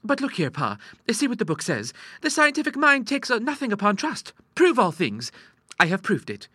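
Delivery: put-on high-pitched girlish protest; earnest daughter. A narrator's reading. Ida quoting her book to her father, earnest. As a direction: argue earnestly in a high young voice.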